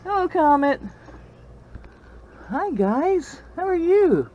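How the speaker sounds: high, bright hello; pet greeting. Real, not acted. Greeting the chickens in a high sing-song.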